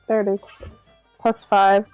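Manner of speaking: ordinary talk, nothing marked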